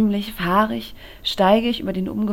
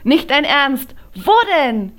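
high and loud, thrilled disbelief; excited shout; 8 semitones higher, 7 dB louder than usual. A narrator's reading. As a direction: blurt it out so the whole bar hears. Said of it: "Silly schreit". (Freundin schreit aufgeregt, hoch und laut)

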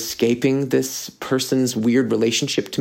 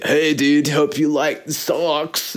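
higher, drawn-out goofy toss-off; mock casual gift-giver; 3 semitones higher, slower than usual. Handing a friend socks as no big deal, mock casual. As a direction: put on a silly, throwaway dude voice. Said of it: "it's more than just, like, a".

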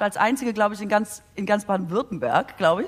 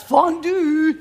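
said louder and higher for clarity; louder repeat; 6 semitones higher, 4 dB louder than usual. Real, not acted. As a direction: call it out so they hear. Repeating word louder for listener. (lauter wiederholt)

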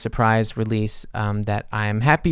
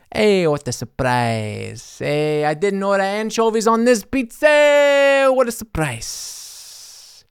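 slow mock surprise; 7 semitones higher, slower than usual. Anchovies on the pizza, slow drawn-out mock surprise. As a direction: savour the fake shock.

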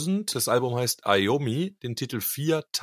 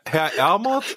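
ordinary delivery

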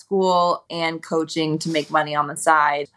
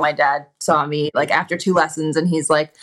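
ordinary talk

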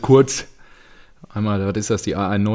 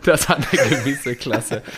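mocking echo; slower than usual. Mocking echo of friend's phrase.

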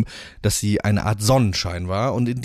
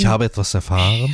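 quoting Alan. Retold or imitated. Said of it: "quoting Alan's catchphrase".

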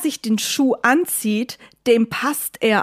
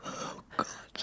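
whisper; 20 dB quieter than usual. Acted. Hiding, whispering in fear.

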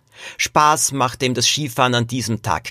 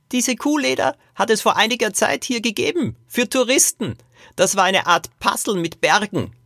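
put-on high-pitched old man recalling; reminiscing great-grandfather; 11 semitones higher than usual. Great-grandfather recalls the tourist puzzles for the four, high reminiscing voice.

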